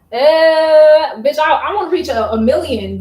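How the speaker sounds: loud, insistent burst; emphatic declaration. Real, not acted. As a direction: belt out the goal, starting high and loud. Emphatic self-correction while manifesting.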